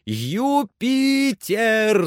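very high, long-drawn name call; calling shout. A narrator's reading. Master calls his climber, ignoring his friend, drawn-out shout. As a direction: stretch every syllable to reach the treetop. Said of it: "закричал он".

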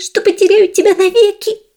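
high weeping mouse voice; tearful whisper. Acted. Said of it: "плача шептала".

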